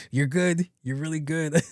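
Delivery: high, chuckled concession; laughing admiration. Acted. Patient outwitted by nursing assistant, laughing admiration.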